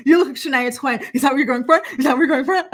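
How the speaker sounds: loud, high mocking imitation with forced laughter; fake Botox laugh. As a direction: mimic a fake charmer laughing too hard at his own line. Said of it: "he does his fake Botox laugh".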